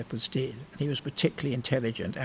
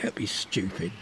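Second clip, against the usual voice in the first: dismissive mother; 6 semitones lower, 3 dB quieter than usual. Retold or imitated. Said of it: "She said".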